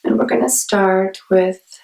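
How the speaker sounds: unhurried meditation guide with long pauses; guided meditation. Acted. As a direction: ease listeners into calm, phrase by phrase.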